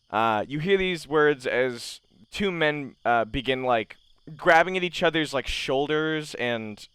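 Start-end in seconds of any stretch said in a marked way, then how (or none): none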